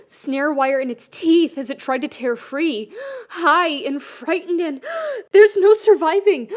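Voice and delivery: breathless panic building; panicked gasping. Acted. Keith caught in panic, gasping like a trapped animal.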